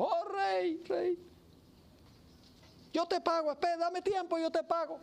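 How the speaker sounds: high, begging plea to the king; desperate servant pleading for mercy; pleading debtor. Acted.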